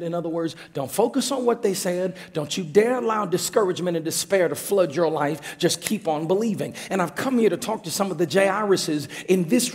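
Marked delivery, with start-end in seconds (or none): none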